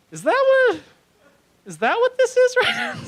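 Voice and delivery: high, loud disbelieving squeal; shocked high squeak. Retold or imitated. Comic telling himself off in a high shocked squeak.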